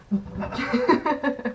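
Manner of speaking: high burst of laughter. Real, not acted. Narrator recalling castmate's shiver, breaking into laughter. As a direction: laugh out as it hits.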